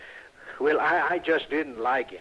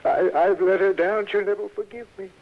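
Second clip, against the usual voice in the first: louder, higher, rushed self-reproach; higher, pained wail of remorse; anguished outburst; 6 semitones higher, 4 dB louder than usual. Acted. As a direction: cry out in guilty despair. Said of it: "His honest distress".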